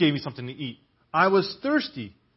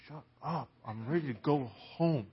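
slow, softer, put-on belly voice, lightly done; voicing his grumbling belly; 3 semitones lower, 9 dB quieter, slower than usual. Preacher voicing his own hungry belly to the congregation, slow mock grumble. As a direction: drawl it out like an impatient hungry stomach. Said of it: "my belly's like".

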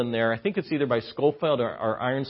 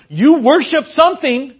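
louder and higher, pounding it home; preaching climax; 9 semitones higher, 8 dB louder than usual. Real, not acted. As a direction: drive the point home to the congregation. Sermon builds to climax.

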